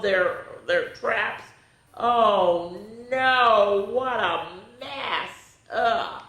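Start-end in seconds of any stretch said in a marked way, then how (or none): none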